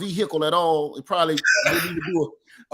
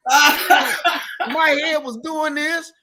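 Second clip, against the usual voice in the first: laughing through the words, higher; slow, laughing and higher; 8 semitones higher, 5 dB louder, much slower than usual. Real, not acted. Laughing while retelling.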